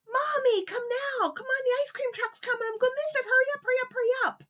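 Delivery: very high, loud urgent begging; desperate little boy. Acted. Kevin stuck in the tree begging Mommy to hurry, high urgent voice. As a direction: beg like a kid about to miss the truck.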